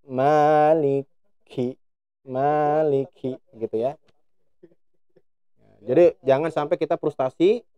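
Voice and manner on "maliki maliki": "Maliki" slow with the air released with the kaf; aspirated kaf. Shown as a demonstration. Teacher shows the final correct kaf to students. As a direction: puff on the kaf, in time. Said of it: "bersamaan dengan keluarnya huruf kaf".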